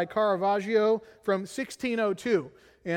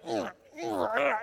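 imitated baby grunts; 6 semitones higher than usual. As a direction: grunt like a frowning newborn. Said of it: "She's like".

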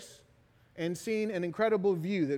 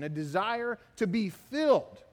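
usual speaking voice

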